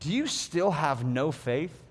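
low, slow and gentle; soft, slow question. Retold or imitated. Jesus asking his disciples about faith, soft and slow. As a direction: ask it softly, lingering. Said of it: "he follows up that question with".

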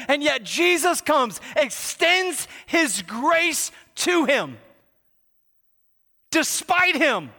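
high, emphatic pulpit delivery; full-force preaching. Real, not acted. Preacher to church on God's grace, impassioned.

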